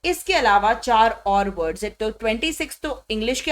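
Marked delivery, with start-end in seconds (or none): none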